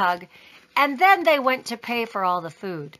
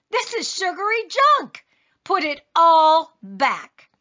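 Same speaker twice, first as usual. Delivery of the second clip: higher exasperated scold, slowing at the end; scolding father; 6 semitones higher, 4 dB louder than usual. A narrator's reading. Father scolds Taya over candy, exasperated.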